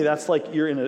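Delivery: normal talking voice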